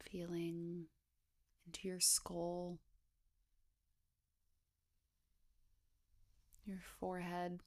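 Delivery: hushed, even body-scan voice with long pauses; guided meditation. Acted. Guide inviting listeners to scan down the body, guided meditation. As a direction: gently lead a meditation, unhurried, leaving space between phrases.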